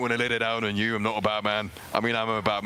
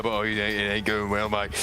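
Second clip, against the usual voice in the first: ordinary talk, nothing marked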